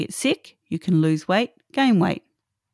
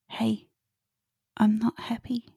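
soft, airy whisper in the ear; 4 semitones higher, 6 dB quieter than usual. Acted. The body first whispers a warning in your ear, whispering. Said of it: "first it whispers in your ear".

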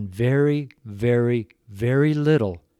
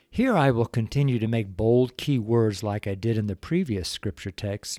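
ordinary talk, nothing marked

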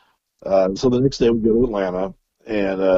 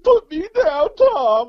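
squealing protest; 4 dB louder, slower than usual. Retold or imitated. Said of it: "he's like".

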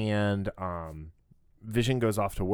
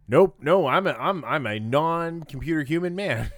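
higher, louder, awkward robot insisting; stiff android voice; 6 semitones higher, 4 dB louder than usual. Retold or imitated. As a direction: play a nervous android covering up. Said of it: "the guy's like".

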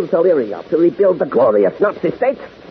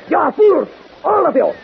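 angry rebuke; 6 semitones higher than usual. Acted. Grand Master berating his henchmen.

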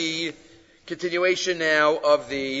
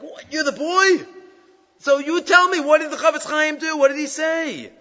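much higher, excited, urgent questioning; astonished; 12 semitones higher, 3 dB louder than usual. Acted. Astonished rabbi questioning the boy from the story, excitedly. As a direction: burst out in disbelief and press for the answer.

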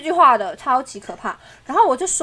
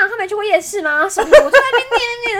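nagging wife; 7 semitones higher, 7 dB louder than usual. Retold or imitated. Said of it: "就像我就像个臭，臭大老婆一样".

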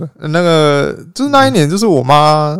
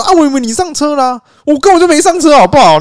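mother snapping back; 12 semitones higher, 5 dB louder than usual. Retold or imitated. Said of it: "然后我妈就说".

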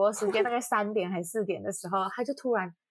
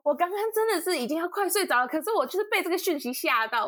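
mock nervous friend; 8 semitones higher than usual. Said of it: "他说的多紧张".

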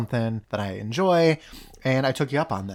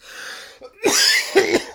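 choking cough. Real, not acted. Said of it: "I may need the Heimlich".

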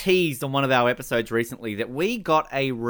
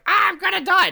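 mock panic; 6 dB louder than usual.

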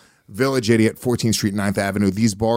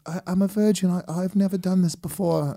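higher-pitched put-on British girl voice; fake British accent; 6 semitones higher, 4 dB quieter than usual. Girl admits her inexperience to him, fake British accent. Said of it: "faked the British accent".

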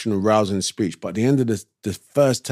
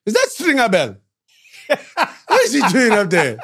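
higher, laughing voice of a doubtful onlooker; mocking onlooker; 11 semitones higher than usual. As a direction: play a skeptical fan in the crowd, chuckling. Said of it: "I even heard someone say".

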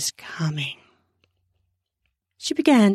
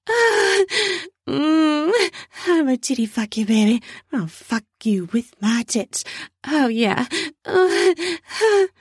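much higher, fast, moaning come-on; moaning woman; 9 semitones higher than usual. Acted. Candy teasing Kevin in a much higher moaning voice. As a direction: coo it up high as the eager woman.

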